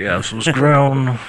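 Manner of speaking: drawn-out aristocratic announcer; George Plimpton. Pretend. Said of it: "it has to be like George Plimpton".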